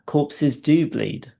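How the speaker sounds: patient twisting the evidence, slow mock wonder; mock amazed discovery. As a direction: savor the twist like a sudden revelation. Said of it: "And he goes".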